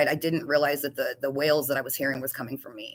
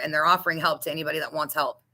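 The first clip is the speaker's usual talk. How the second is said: ordinary talk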